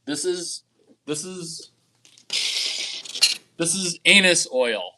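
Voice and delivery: slow, drawn-out comic build-up; slow dramatic reveal. Pretend. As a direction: stretch it out for the punchline.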